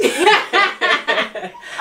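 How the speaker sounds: high burst of laughter; loud laughter. Real, not acted. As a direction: cracks up laughing. Bursts out laughing at jerk rice.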